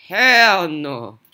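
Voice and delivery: slow, loud dismay; loud drawn-out "hell no". Real, not acted. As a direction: stretch it out in loud protest. Scared in the horror game.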